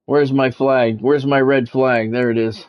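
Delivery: ordinary talk, nothing marked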